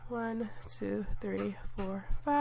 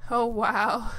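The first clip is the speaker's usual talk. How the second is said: surprised; 9 dB louder than usual. Real, not acted. Sees a funny random sim.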